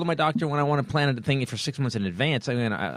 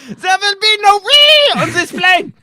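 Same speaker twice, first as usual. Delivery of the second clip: put-on much higher, much louder German accent, barking orders; a strict German flight attendant shouting commands; 12 dB louder, slower than usual. German stewardess shouts orders at passengers, German accent. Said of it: "She, she forgot to tell them".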